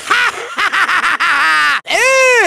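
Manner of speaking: scornful villain cackle; big mocking "ha ha ha"; evil laugh. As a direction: cackle in rapid, rolling bursts. Villain scoffs at the idea.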